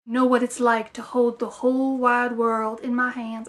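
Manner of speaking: attempted accent slipping into Southern; bad Southern accent. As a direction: slide into a clumsy Southern accent. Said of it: "I turned to a Southern accent".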